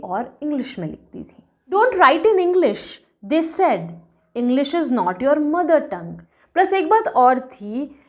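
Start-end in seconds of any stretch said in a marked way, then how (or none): 1.7-6.1 slow, emphatic poem reading; measured recitation, opening higher. A narrator's reading. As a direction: read the verse slowly, leaning on key words. Reads the poem aloud.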